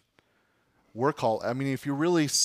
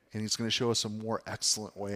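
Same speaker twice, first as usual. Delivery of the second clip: ordinary talk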